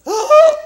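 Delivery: loud, high startled crowd cry; crowd gasp-shout. Retold or imitated. Students reacting to the punk leaping, loud startled cry. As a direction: yelp like a startled school crowd. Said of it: "the whole crowd of students went".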